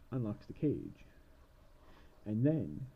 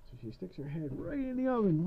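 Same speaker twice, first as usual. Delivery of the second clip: ordinary talk, nothing marked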